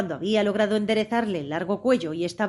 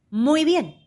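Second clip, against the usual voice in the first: a high, raised call; shout; 7 semitones higher, 4 dB louder than usual. A narrator's reading. Queen shouts her approval to the soldiers. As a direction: call it out loud. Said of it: "gritó la reina".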